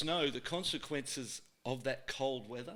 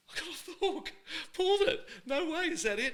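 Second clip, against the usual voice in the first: relieved disbelief; 6 dB louder than usual. Retold or imitated. Kid relieved after dad leaves.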